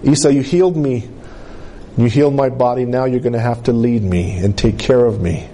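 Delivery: low-pitched, earnest retold prayer; inward prayer. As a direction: voice a girl's private prayer, pitched well down. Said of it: "she said a prayer in her heart".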